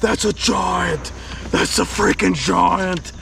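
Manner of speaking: slow, high, stretched-out amazement; thrilled shout. Real, not acted. Angler spotting a huge bass on his line, thrilled.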